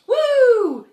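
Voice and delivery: loud, high swooping "woo"; high whoop. Pretend. Cow leaping over the moon, high loud whoop.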